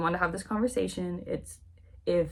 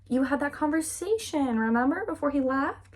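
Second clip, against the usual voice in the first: higher-pitched, acted prodding of a forgetful friend; coaxing reminder; 7 semitones higher than usual. Isabella reminding Catherine of the talk with her brother, coaxing. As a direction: jog her memory with a leading lilt.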